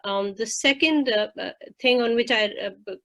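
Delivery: normal talking voice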